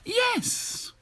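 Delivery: a sudden very high squeal of yes; an eager witch yelps her yes; witch crying yes. Acted. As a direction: fling it out way up high. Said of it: "cried the witch".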